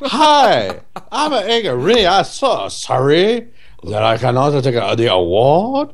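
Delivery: high, sing-song mock Chinese accent, hammed-up; mock Ang Lee accent. Ang Lee accepting an award, mock Chinese accent. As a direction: mangle the name into Angaree in a broad put-on Chinese accent. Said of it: "impression of Ang Lee".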